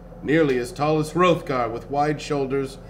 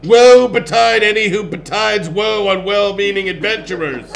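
loud, higher barbarian comeback; retort; 5 semitones higher, 9 dB louder than usual. A narrator's reading. Barbarian answers the doorman's threat, loud retort. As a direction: fire the threat right back, loudly. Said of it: "he retorted".

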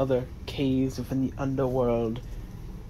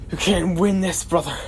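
higher, loud, pleading god; a god warning his brother; 3 semitones higher, 4 dB louder than usual. Acted.

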